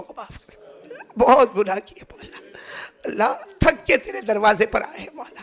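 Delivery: crying in prayer. Real, not acted. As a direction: voice breaking into sobs.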